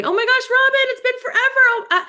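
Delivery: high, cheery catch-up call voice; gushing friendly caller. Retold or imitated. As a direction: gush like you've missed an old friend. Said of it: "if you reach out to somebody".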